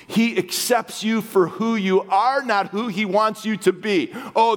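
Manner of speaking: normal talking voice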